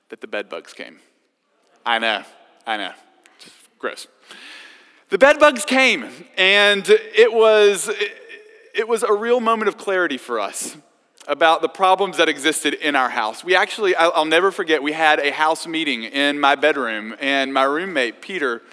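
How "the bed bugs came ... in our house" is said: excited. Real, not acted. Dramatic reveal of bed bugs.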